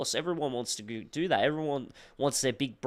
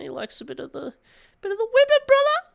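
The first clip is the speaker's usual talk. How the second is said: squealing high camp voice, loud at the end; 6 dB louder than usual. Pretend. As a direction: swoop up into a shrieking camp squeal. Teasing friend as "fruity.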